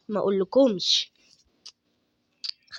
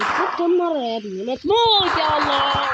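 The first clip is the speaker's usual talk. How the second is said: frustrated outburst; exasperated yell; 5 semitones higher, 6 dB louder than usual. Real, not acted. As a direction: yell it at the enemy that won't die. Enemy keeps not dying.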